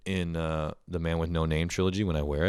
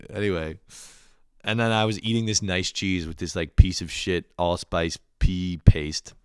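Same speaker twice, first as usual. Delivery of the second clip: ordinary delivery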